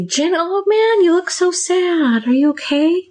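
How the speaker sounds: high, slow, drawn-out concern; overdone sympathy. Acted. Therapist projecting sadness onto Jen, overdone sympathy.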